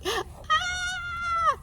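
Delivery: very high piggy shriek; squealing pigs; pig scream. Acted. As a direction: shriek in panic. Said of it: "they're like".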